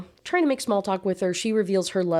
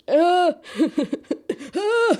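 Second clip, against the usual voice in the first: loud, very high "uh" with laughter; exasperated squeals; 12 semitones higher, 5 dB louder than usual. Real, not acted. Mock disgust at mom's approval.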